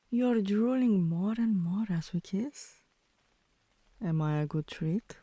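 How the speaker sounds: usual speaking voice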